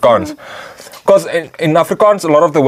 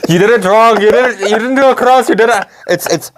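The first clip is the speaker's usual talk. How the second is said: much higher excited correction; high-pitched, urgent correction; 9 semitones higher than usual. Real, not acted. Correcting game input excitedly.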